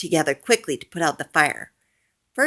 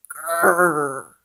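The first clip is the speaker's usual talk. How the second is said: werewolf growl. A narrator's reading. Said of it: "says the werewolf".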